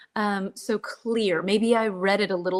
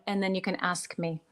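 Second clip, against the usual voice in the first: ordinary delivery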